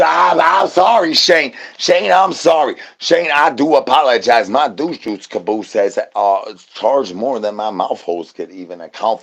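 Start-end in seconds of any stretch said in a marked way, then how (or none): 0.0-4.5 mock shouted apology. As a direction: yell the apology at Shane, half joking. Loud apology to Shane.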